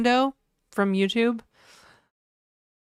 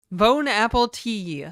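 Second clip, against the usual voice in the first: slow, louder pun in a bad French accent; mock French accent; 3 semitones higher, 5 dB louder, slower than usual. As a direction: Bon apple tea as fake French. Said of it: "terrible French accent".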